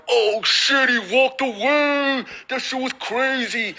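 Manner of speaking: high-pitched, awestruck hollering; amazed onlooker. Acted. Onlookers watching a magical walk-away, hammed-up amazement.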